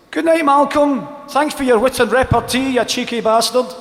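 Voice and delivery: high, sing-out sarcastic farewell; mock cheery retort. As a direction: call it out with exaggerated cheer. Imagined parting retort to manager.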